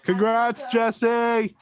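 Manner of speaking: high, bright cheer; excited. Real, not acted. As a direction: cheer her on. Cohost congratulating loudly.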